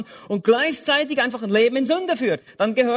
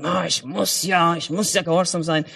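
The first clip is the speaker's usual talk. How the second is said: mock religious duty; 4 semitones lower than usual. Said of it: "nicht wieder dieses religiöse".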